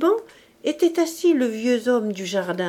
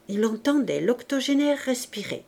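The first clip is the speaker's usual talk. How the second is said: usual speaking voice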